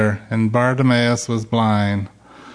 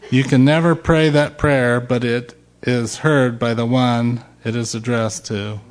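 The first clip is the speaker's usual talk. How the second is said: normal talking voice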